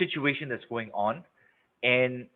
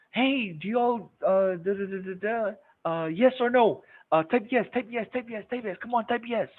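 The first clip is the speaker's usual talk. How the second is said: mock pushy presenter; 9 semitones higher than usual. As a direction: whine for answers like a frantic host.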